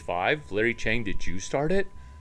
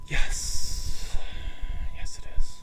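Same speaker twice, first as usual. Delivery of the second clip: breathy unvoiced whisper of a reply; 4 dB louder, slower than usual. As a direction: whisper the answer like a secret. Answers himself in whisper.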